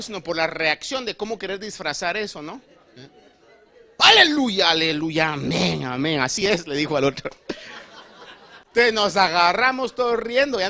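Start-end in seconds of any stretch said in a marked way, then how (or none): 4.0-6.2 hammed-up loud amens; loud fake fervor. Woman faking fervent prayer after waking. 6.2-6.7 louder, pushy "así es"; hammed-up insistence; loud mock agreement. Woman seeking agreement from neighbor.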